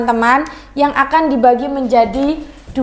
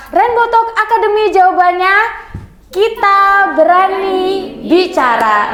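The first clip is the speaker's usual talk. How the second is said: higher-pitched, rousing slogan; spirited intonation; 5 semitones higher than usual. Shown as a demonstration. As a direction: call it out like a rallying chant, dropping on the last word. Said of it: "nah beda kan".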